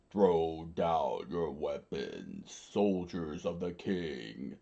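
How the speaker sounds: low, slow, drawn-out royal order; commanding king. A narrator's reading. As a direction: proclaim it slowly in a deep voice. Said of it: "the king screamed out".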